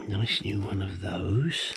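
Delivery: slow breathy whisper of "Nice new one of those"; whispered aside about "Nice new one of those". Shown as a demonstration. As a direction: hush it like a secret, slowly. Whispered repeat of the phrase.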